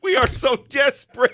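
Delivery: high, loud cry of desperation; a hammed-up wail of despair; mock desperate shout. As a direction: belt it out like a fan at the end of their rope. Jokingly declaring team desperation.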